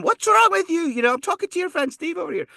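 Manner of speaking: very high, hammed-up Scottish telling-off; scolding Scottish mom. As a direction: scold your son as a Scottish mother. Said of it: "She's kicking him".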